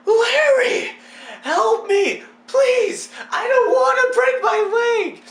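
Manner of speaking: high, loud mock plea for help; hammed-up freak-out. Hanging from gutter, calling the neighbor, hammed-up panic. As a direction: yell desperately to a neighbor, overacting the terror. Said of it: "I'm freaking out like".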